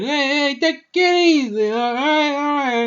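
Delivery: high, loud, worked-up club boss; Penguin impression. Penguin placating Batman at the club, high loud character voice. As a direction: shout it high, calming someone down. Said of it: "I love, uh, Colin Farrell's, uh, Penguin".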